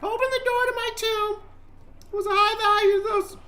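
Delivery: very high put-on soul voice; squeaky little spirit bargaining; high-pitched voice. Trapped soul offers explorer a deal, high-pitched voice. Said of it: "I couldn't even do my high-pitched voice".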